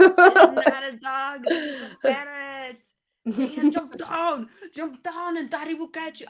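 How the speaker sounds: loud, slow, high Arnold movie voice; hammed-up Arnold line, laughing; Arnold impression. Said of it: "I love Jingle All the Way".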